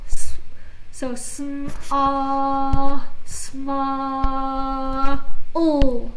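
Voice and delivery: "sma" stretched sound by sound; sounding out words. Shown as a demonstration. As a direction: blend "S-, so sm, ah, sma, ol" slowly. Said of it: "This is ah".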